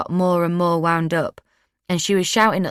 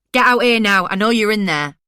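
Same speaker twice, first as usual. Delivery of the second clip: loud, higher, demanding yell; angry shout; 4 semitones higher, 5 dB louder than usual. A narrator's reading. Narrator yelling at the house for Ashtiak, angry shout. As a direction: bang on the door with your voice. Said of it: "I shouted".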